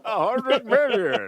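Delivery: high, loud, slow villain boom, laughing; Doom voice. Retold or imitated. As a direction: ham up a Doctor Doom voice. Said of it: "he did the Doom voice and everything".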